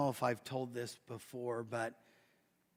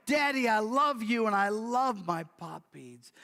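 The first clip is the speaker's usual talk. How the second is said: bursting with joy; 6 semitones higher, 4 dB louder than usual. Acted.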